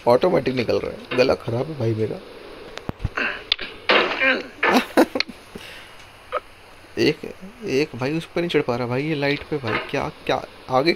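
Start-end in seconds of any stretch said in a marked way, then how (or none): 2.8-5.3 long airy squeaky laugh; high breathless laugh. Real, not acted. Laughing at his own bad luck, high and wheezy.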